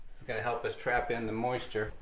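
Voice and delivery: usual speaking voice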